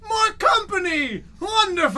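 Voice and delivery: high, slow shouted monster greeting; excited creature voice. Acted. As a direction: boom it out like a thrilled creature. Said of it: "you hear a voice go".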